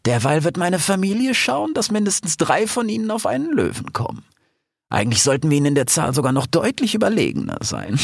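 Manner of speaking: high-voiced scheming lioness plans the attack; a cunning lioness savouring her plan, pitched high. Aisha plotting the revolt with her pack, high and scheming.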